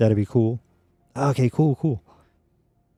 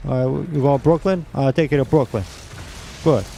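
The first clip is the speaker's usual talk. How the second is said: New York cabbie; 4 semitones higher than usual. Retold or imitated. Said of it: "That's what I should be doing. Driving a cab".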